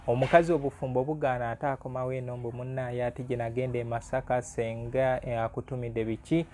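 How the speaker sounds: ordinary talk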